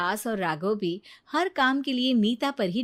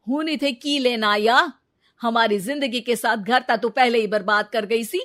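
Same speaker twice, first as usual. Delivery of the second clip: louder, bitter rebuke; angry husband; 2 semitones higher, 7 dB louder than usual. Acted. Raghav rebuking his returning wife, angry. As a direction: confront her at the door.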